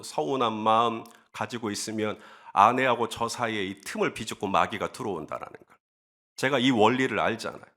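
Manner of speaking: ordinary talk, nothing marked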